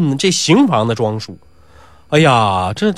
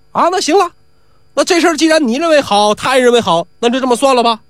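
higher, relieved and brisk; relieved old man; 8 semitones higher than usual. Acted. Old Mao settling the quarrel, relieved and higher. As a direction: wrap it up, glad it's over.

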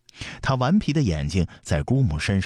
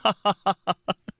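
high staccato ha-ha laugh; hearty peal of acted laughter; big laugh; 14 semitones higher than usual. A narrator's reading. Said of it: "抚掌大笑". (大笑)

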